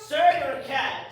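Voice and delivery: loud, slow melodramatic denunciation; indignant outburst. Acted. Guest loudly accusing Jerry of being a cad. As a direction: hurl the insult grandly.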